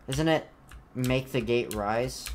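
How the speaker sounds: ordinary talk, nothing marked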